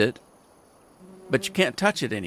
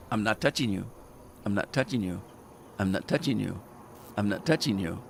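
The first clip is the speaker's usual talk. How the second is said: softer, lower mock child taunt; teasing quiet taunt; 4 semitones lower, 7 dB quieter than usual. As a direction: needle him softly, sing-song. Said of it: "she's poking me".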